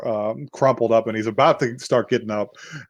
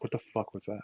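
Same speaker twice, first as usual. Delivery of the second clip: low suspicious mutter; 3 semitones lower, 16 dB quieter, much faster than usual. Retold or imitated. Killer hears phone drop.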